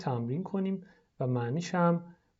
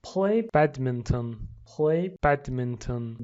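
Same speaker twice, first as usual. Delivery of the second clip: slow model; much slower than usual. Shown as a demonstration. Teacher models for pupils, slow. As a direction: Play badminton said slowly twice. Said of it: "لطفاً تکرار کنید".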